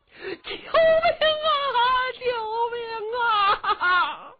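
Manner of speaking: drawn-out, high, loud sobbing scream; cry for help. A narrator's reading. Old man calling for help from the crowd, wailing scream.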